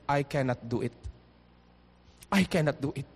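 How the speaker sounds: low, soft voice of giving up; defeated quote. Acted. Preacher voicing people who stop midway, low and defeated. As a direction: voice a quitter, dropping low and quiet.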